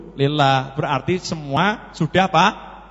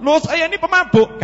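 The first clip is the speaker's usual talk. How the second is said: high, louder startled objection; incredulous protest; 12 semitones higher, 5 dB louder than usual. Acted. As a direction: protest in acted disbelief. Drunkard protests being called a saint. (protes kaget tak percaya)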